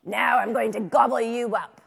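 loud, rough troll roar; menacing troll. A narrator's reading. Troll threatening to eat the little goat, roaring. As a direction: threaten as the troll, at full volume. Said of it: "said the troll".

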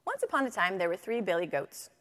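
normal talking voice

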